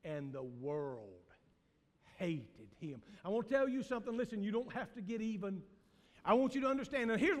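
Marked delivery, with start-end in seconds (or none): none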